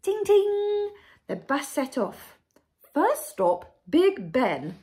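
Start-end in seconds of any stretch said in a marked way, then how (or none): 0.0-0.9 high, loud ringing ting; bus bell. A narrator's reading. 2.9-4.8 grand queen. A narrator's reading. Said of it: "said the Queen".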